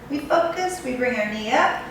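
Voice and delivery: ordinary talk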